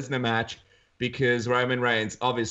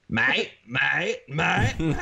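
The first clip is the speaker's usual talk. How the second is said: broad Australian mates getting louder; building mate; 4 semitones higher, much slower than usual. Retold or imitated. Pub brawlers squaring up, building broad Australian mates. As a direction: escalate each mate like a brewing pub fight. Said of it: "calling each other mate louder and louder".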